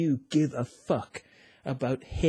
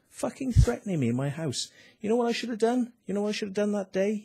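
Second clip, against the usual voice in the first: indignant outburst; 9 semitones higher, faster than usual. Real, not acted. Furious at being threatened at home, high indignant outburst. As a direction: pitch jumps much higher in outrage. Fuming about the officer's visit.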